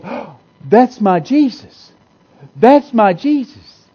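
slow and loud, building with joy; excited recognition. Retold or imitated. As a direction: cry it out like you finally see him. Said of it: "you're gonna be so excited".

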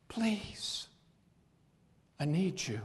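whispered plea. Acted. Jesus begging his people softly, whispered plea. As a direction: let the plea sink to a whisper.

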